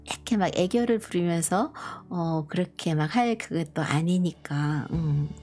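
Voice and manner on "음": usual speaking voice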